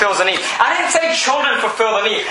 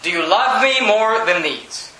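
slow; slower than usual. Retold or imitated. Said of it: "And he's saying".

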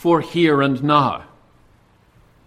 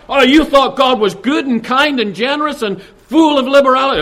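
higher, louder mocking taunt; taunting tempter; 7 semitones higher, 6 dB louder than usual. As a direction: sneer at her naivety. Voicing Satan's taunt.

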